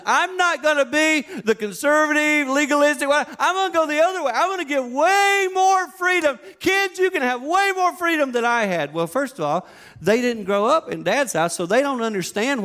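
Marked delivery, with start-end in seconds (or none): 0.1-8.9 high, loud, excited resolve; declaring it with big, over-the-top excitement; defiant vow. Retold or imitated. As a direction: swear you'll do it the opposite way. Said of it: "and I said".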